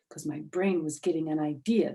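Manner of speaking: plain reading voice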